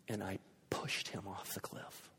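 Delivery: hushed, breathy whisper; suspenseful whisper. Real, not acted. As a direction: draw them in with a whisper. Suspenseful climax of the story.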